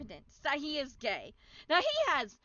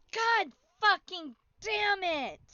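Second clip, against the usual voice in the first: angry; 4 semitones higher, 4 dB louder, slower than usual. Acted. Reading angry chat aloud.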